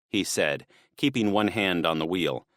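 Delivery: ordinary delivery, nothing marked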